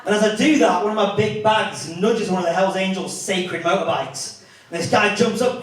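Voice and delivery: ordinary talk, nothing marked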